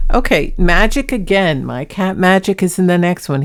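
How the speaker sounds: normal talking voice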